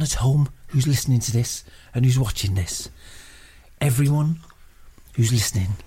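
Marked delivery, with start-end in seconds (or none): none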